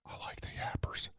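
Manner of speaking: whispered, like a guilty secret; confessional whisper. Real, not acted. A big-dog guy admits to listeners he likes small dogs, whispering. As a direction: let it slip out under the breath.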